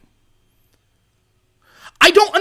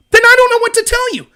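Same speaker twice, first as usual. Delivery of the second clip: exasperated; 9 semitones higher, 3 dB louder than usual. Real, not acted. Exasperated at people not upset.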